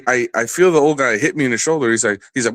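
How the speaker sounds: ordinary delivery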